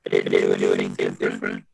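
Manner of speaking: glitchy low robotic voice; distorted robot voice over a bad line. Real, not acted. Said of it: "Your voice is messed up".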